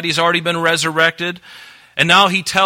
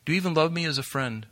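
softer, tentative question; gentle lowered appeal; 3 semitones higher, 7 dB quieter, faster than usual. Acted. Jesus to Peter at his level, soft appeal. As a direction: ask softly, coming down to their level. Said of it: "he comes down to Peter's level".

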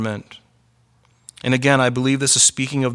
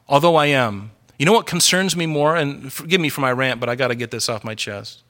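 usual speaking voice

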